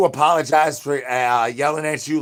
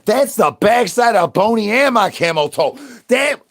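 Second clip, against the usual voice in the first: loud excited brag; 7 semitones higher, 6 dB louder than usual. Acted. Loud high showing off.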